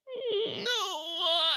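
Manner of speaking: high, soft crying protest; mourning the twist; anguished whine. Real, not acted. Heartbroken over Alton's death.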